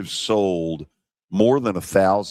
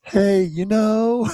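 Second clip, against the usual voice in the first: high, slow sing-song through a laugh; laughing sing-song imitation of Frank Wycheck; sing-songy Frank impression; 13 semitones higher, slower than usual. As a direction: mimic the old friend's sing-song lilt, way up high. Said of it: "it was kinda sing-songy".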